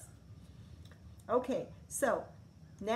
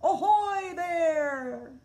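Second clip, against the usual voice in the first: loud, high, slow pirate hail; pirate shout; 8 semitones higher, 9 dB louder, much slower than usual. A narrator's reading. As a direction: bellow it out like a pirate on the bow. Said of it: "like a pirate on the bow of a ship and shouted".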